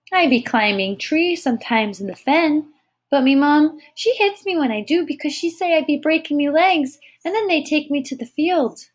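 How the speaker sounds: put-on high, earnest toddler voice; small child. A narrator's reading. Tyke tells Thomas of climbing, high child's voice. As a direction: chatter earnestly as a little tyke.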